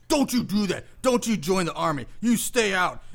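mock-angry Chef warning; Chef freaking out. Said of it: "Chef freaks out".

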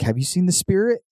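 high, needling question; lightly mocking dig at the writer; mocking jab.

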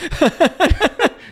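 loud, high cackle breaking out; can't hold the laugh in; burst of high laughter. Real, not acted. Laughing at his own comeback to the guy, loud and high.

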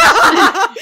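loud, high burst of laughter; real laugh. Real, not acted. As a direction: burst out laughing at a friend's joke. Laughs at friend's joke.

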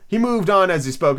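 plain reading voice

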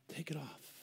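whispered command; whispered repeat; whispered Jesus. Acted. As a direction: whisper it, gentle but firm.

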